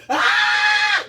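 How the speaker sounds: piercing high loud scream; full scream. Shown as a demonstration. Showing the listeners a release scream: piercing. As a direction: scream it all out. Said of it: "I feel like screaming".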